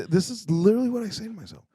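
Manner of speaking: ordinary talk, nothing marked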